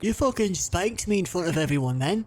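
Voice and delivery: high, whiny wounded guard; sulky guard voice. Acted. Guard complains to Guy about being spanked, high whiny sulk. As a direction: pout like a hurt brute.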